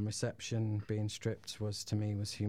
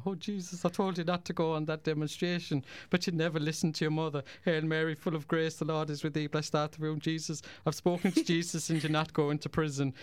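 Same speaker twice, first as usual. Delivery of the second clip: much higher and louder Irish mum scolding and praying; pitched-up, loud Irish mum pleading with Jesus; 7 semitones higher, 5 dB louder than usual. Retold or imitated. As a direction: play the frantic Irish mother, pitched way up. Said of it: "she was like".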